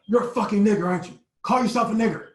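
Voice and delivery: high, loud hateful yelling; going off on us. Retold or imitated. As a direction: scream the order at the boys. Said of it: "he starts just going off on us".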